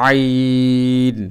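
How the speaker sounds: আইন drawn out slowly from mid-throat; soft ain from the throat. Shown as a demonstration. Teacher demonstrating Arabic ain, slow and soft. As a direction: stretch আইন softly from the throat. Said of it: "খুব সফটভাবে উচ্চারণ হবে".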